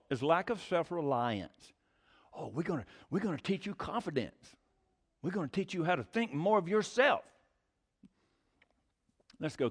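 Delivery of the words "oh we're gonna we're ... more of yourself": a much softer, sneering mock promise; a hint of smug huckster; mocking sales pitch. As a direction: parody the self-help salesman quietly. Mocking the self-reliance preachers.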